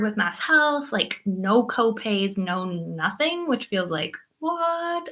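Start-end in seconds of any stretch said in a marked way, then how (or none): 4.4-5.1 high, soft disbelieving what; amazed little what; surprised. Real, not acted. Amazed at no copays.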